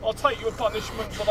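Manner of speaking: soft, resigned surrender; hushed, cowed giving in; meek submission. Acted. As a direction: accept it quietly. Gives in to the bully.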